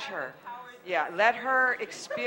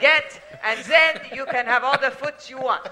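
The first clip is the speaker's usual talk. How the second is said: excited French flourish; 4 semitones higher, 7 dB louder than usual. Pretend. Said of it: "With a French accent".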